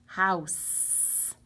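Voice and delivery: "house" drawn out slowly with a long final s; "house" sounded out slowly for spelling, lingering on the s; stretched-out word. Shown as a demonstration. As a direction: stretch out "house" so each sound can be heard. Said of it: "I'm gonna try to stretch it out".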